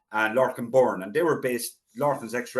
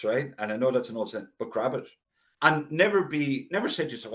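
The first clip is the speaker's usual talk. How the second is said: ordinary talk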